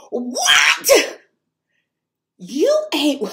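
high, loud incredulous cry; laugh-shout in disbelief; stunned disbelief. Retold or imitated.

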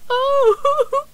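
high, tearful little oh; sniffling. A narrator's reading. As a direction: sniff back the tears. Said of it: "sniffed Euston".

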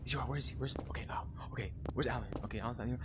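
soft, low, whispered plan; hushed whisper. Real, not acted. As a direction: whisper so the monster won't hear. Hiding from monster, whispering plans.

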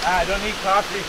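high, jittery and wide awake; excited. Real, not acted. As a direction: blurt it, buzzing from the cold. Wired after cold swim.

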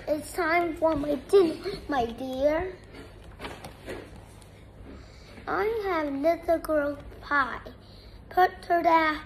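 plain reading voice

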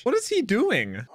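normal talking voice

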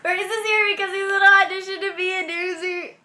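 high-pitched excited gabble; garbled squeal. Real, not acted. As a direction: squeal it so the words blur together. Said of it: "I don't think anyone understood what you just said".

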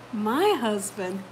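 ordinary delivery

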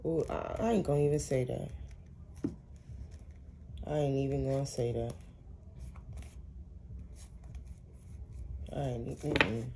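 very soft and low, to yourself; low, hushed reluctance with pauses; hushed refusal. Real, not acted. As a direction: back away from saying it, voice dropped right down. Refusing to repeat what she heard.

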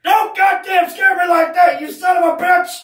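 high furious yell; angry shout. Acted. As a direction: yell at him for the scare. Angry after scare.

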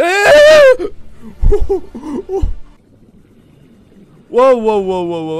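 loud high startled yelp; startled shout. Real, not acted. Gamer startled by a creature, loud surprised yelp. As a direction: yelp in fright.

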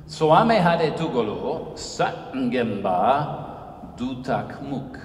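slow, deliberate recitation of the Wolof words; Wolof proverb. Retold or imitated. Speaker recites a Wolof proverb to the audience, slowly and deliberately. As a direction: intone it slowly like an elder's saying. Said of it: "first in the language of Wolof".

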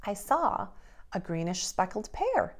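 plain reading voice

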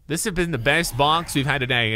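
ordinary talk, nothing marked

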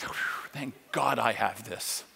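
usual speaking voice

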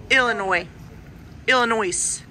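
ordinary talk

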